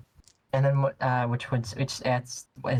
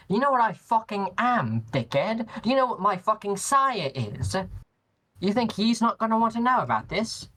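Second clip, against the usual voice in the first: indignant, pitched up and heated; angry; 6 semitones higher than usual. Acted. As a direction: snap back in outrage. Player in character, angry threat.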